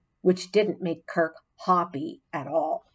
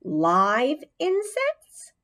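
higher, slow squeaky question; squeak; 7 semitones higher, much slower than usual. A narrator's reading. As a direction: squeak it out in disgust. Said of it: "I squeaked".

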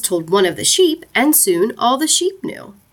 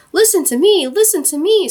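high, mild narrated scream; understated shriek from an insistent goose; 9 semitones higher, 3 dB louder than usual. A narrator's reading. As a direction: demand attention in a raised, urgent pitch. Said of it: "screamed the goose".

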